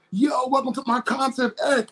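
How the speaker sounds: high hyped stage shout; showy concert performer. Retold or imitated. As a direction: hype up the crowd like a star. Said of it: "they're like".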